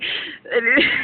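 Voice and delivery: short breathy laugh with a crying edge; choked laugh; nervous laugh. Real, not acted. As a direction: laugh it off in disbelief. Laughs at own rant.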